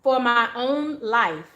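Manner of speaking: slow affirmation with pauses between phrases; affirmation reading. Shown as a demonstration. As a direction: read it slowly so they can take it in. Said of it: "the affirmation associated with this is about".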